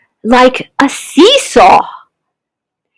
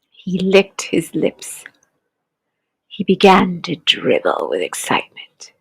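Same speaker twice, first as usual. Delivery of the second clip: hushed; 5 semitones lower, 8 dB quieter than usual. A narrator's reading. Crocodile scene, hushed narration.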